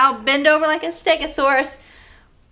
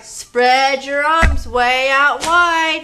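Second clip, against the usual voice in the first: put-on slow sing-song cue to kids; long, stretched call to spread arms; drawn-out instruction; 5 dB louder, much slower than usual. Shown as a demonstration. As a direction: stretch every word out slowly, a bit louder. Leading kids' movement game.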